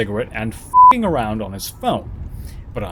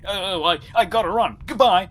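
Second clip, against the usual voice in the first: hurried, rising mock blurt; lightly voiced man rushing to escape; abrupt blurt; 8 semitones higher than usual. Nervous man blurts his exit to her, mildly hurried. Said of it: "he blurts out abruptly".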